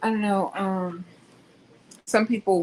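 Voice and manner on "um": normal talking voice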